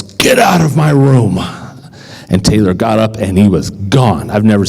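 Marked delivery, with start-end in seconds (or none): 0.0-1.4 mild mock yell. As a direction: bark it as a boy would, half-hearted. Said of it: "and he yells".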